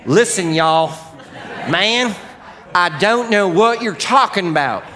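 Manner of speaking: put-on Southern accent, pleading innocence, laughing. Peter denies knowing Jesus in a loud Southern accent. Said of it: "it's from the South 'cause I can do that the best".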